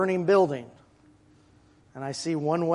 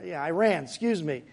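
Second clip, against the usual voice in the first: ordinary talk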